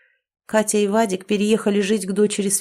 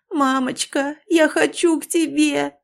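high, pleading cry to her mother; a grown woman begging like a child for her mother; pleading call; 7 semitones higher than usual. A narrator's reading. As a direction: call out for mama, near tears. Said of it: "взывала пожилая женщина".

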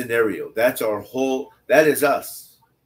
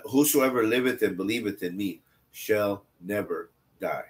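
scripture reading; 4 semitones lower, 4 dB quieter than usual. Acted. Reads scripture aloud.

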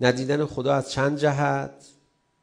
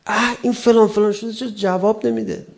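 high, irritated acted grumble; raised-pitch mock outburst of annoyance; exasperated cursing; 10 semitones higher, 4 dB louder than usual. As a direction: act fed up with someone who won't answer. Acts out someone inwardly cursing another.

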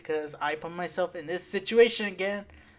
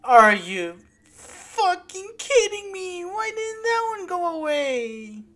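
high, slow, drawn-out yell of disbelief; outraged wail; 12 semitones higher, 6 dB louder, slower than usual. Real, not acted. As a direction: wail it loudly, stretching the words. Enemy would not go away.

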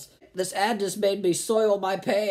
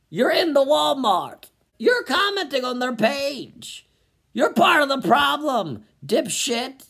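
shout; 4 semitones higher, 4 dB louder than usual. Real, not acted. Yelling at hypocritical commenter.

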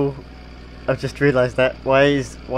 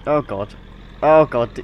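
alarmed; 2 semitones higher, 5 dB louder than usual. Pretend. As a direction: yelp it as the bus lurches. Alarmed as the bus crashes.

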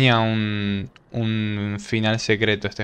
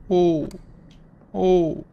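ordinary delivery

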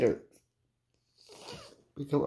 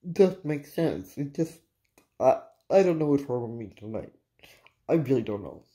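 ordinary talk, nothing marked

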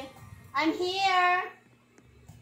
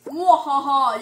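loud villain cackle; evil laugh; 3 semitones lower, 7 dB louder, slower than usual. Pretend. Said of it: "Let me become a villain".

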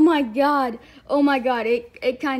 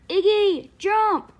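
cheering shout; 7 semitones higher, 4 dB louder, much slower than usual. Acted. Cheering a brother to jump.

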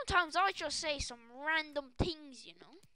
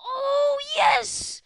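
high, loud, stretched-out yell; ecstatic shouting; 8 semitones higher, 7 dB louder, much slower than usual. Real, not acted. Streamer celebrating a four million coin player, ecstatic shouting. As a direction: scream the win slowly, word by word.

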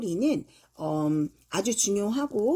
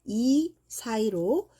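slow demo; much slower than usual. Shown as a demonstration. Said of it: "텅을 내밀면서 발음을 하셔야 돼요".

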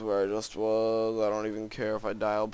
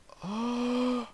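long high gasp; 14 semitones higher than usual. Real, not acted. Shock at map trap.

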